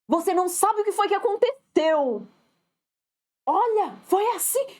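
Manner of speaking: high-pitched, over-dramatic gossip opener; exaggerated. Colleague arriving with news to tell, exaggerated. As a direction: build up the news with mock drama. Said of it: "Ela exagera".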